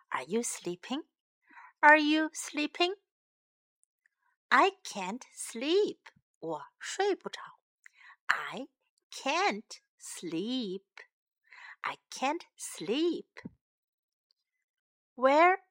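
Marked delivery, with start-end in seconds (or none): 0.1-1.1 soft unvoiced whisper. Shown as a demonstration. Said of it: "time to learn some English". 8.3-10.9 whisper. Shown as a demonstration. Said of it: "time to learn some English". 11.8-13.3 slow unvoiced whisper. Shown as a demonstration. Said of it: "time to learn some English".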